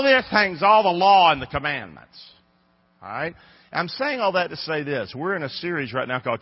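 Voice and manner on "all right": ordinary talk, nothing marked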